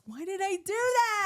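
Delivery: high, slow exasperated wail; comic regret. Retold or imitated. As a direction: whine at your own mistake. Retold regret.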